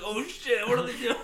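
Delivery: high-pitched astonishment, breaking into laughter; mock panicked excitement. Whole lake having a heart attack, high mock astonishment. Acts out whole lake's startled reaction.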